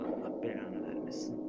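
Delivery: alçak sesle fısıltılı beddua. Real, not acted. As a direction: sesi hiç vermeden fısılda. Hiding from the nun, cursing. (saklanırken kendi kendine fısıltılı küfür)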